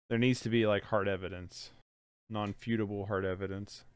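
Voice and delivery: normal talking voice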